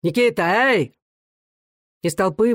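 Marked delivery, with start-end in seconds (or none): 0.0-1.0 high-pitched summoning call; insistent high hail. A narrator's reading. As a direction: call out to someone in the crowd. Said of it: "громко позвал Становой, оглядываясь".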